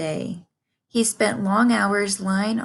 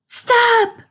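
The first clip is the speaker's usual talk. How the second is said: piercing high, loud piglet shriek; a terrified pig shrieking; screamed; 8 dB louder than usual. A narrator's reading. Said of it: "screamed Wilbur".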